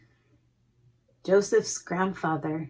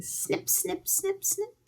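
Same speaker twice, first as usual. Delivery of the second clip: snip sounds; 10 semitones higher, 8 dB quieter than usual. A narrator's reading. Scissors sound in story.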